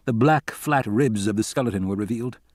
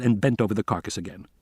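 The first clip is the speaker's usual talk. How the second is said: ordinary delivery, nothing marked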